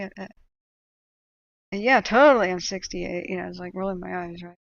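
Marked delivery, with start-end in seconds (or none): 1.7-3.3 sarcastic playing along. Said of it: "I kinda played along".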